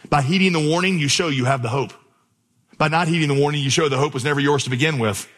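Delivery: usual speaking voice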